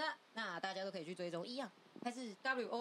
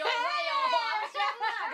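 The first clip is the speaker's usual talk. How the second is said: laughing squeal; 13 semitones higher, 12 dB louder, faster than usual. Real, not acted.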